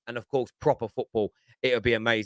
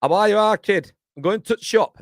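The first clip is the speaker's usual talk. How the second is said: loud, higher-pitched mock Yorkshire accent; 5 semitones higher, 9 dB louder than usual. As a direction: put on a big, loud Yorkshire voice. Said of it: "He talks like that, you see".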